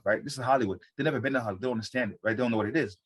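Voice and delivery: ordinary talk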